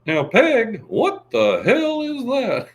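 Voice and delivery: very high, slow, exasperated Texan; Hank Hill impression. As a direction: scold Peg, high and drawn out. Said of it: "I always remember when he said".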